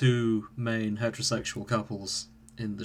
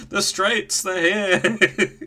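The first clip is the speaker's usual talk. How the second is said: mock alarm; 7 semitones higher, much slower than usual. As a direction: raise the joke alarm in a high voice. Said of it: "a special sound effect for heterosexuals".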